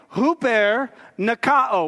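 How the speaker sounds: huper nikao said slowly, hoo-PAIR nuh-KAH-oh; Greek pronunciation demo. Shown as a demonstration. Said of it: "you can say it with me".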